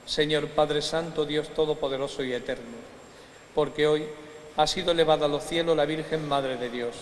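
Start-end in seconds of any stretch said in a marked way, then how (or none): none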